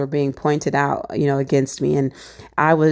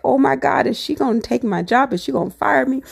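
higher, rushed panicky questions; mock-frantic self-talk, pitched up; panicked inner voice; 5 semitones higher than usual. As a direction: spiral into worst-case fears. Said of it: "I'm freaking out".

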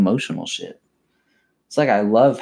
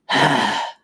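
gritty throat rasp; scream growl demo; 4 semitones higher than usual. Shown as a demonstration. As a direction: breathe out a rough growl. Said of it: "if you do that and you go".

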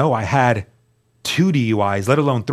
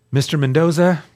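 louder, higher mock judge addressing the defendant; formal judge voice; 5 semitones higher, 4 dB louder than usual. Judge calling the defendant's name, a formal courtroom address. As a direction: announce his name like a judge from the bench. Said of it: "this is how they talk".

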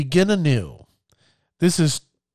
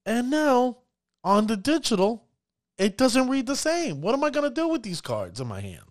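higher, whiny complaining player voice; annoyed player; 7 semitones higher than usual. Retold or imitated. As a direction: play a frustrated gamer griping about his hand. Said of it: "look at their cards and go".

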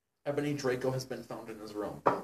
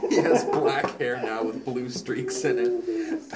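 laughing while reading; 3 semitones higher, 9 dB louder, slower than usual. Real, not acted. Reader cracks up mid-reading.

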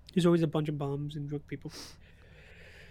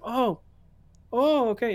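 higher-pitched retold "oh" as it clicks; dawning realization; 6 semitones higher, 5 dB louder, slower than usual. Drunk man finally understands he nearly got hit, dawning realization. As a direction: imitate a man finally catching on.

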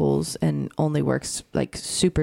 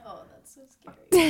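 much higher, exclaiming; shocked sympathy; 12 semitones higher than usual. Real, not acted. Reacting with shock to the co-host's sweating story.

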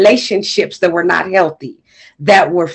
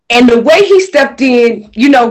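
usual speaking voice

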